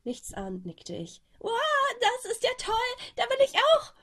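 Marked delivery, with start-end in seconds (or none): 1.4-3.9 high, loud squeal of delight; overjoyed. Acted. Boy thrilled to join the project, loud high squeal. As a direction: burst out with childish glee.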